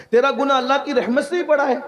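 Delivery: high-pitched, emphatic challenge; incredulous question. Retold or imitated. The master questions the sinner about God's mercy, incredulous.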